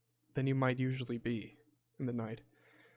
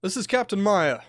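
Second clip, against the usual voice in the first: loud, raised captain's announcement; calling out; 5 semitones higher, 8 dB louder than usual. Acted. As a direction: call firmly through a closed door. Captain calling through a closed door.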